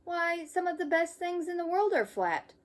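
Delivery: a hint of a cheerful mother.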